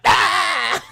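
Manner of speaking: high mock scream, hammed up. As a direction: shriek like the wrestler did. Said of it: "and she's like".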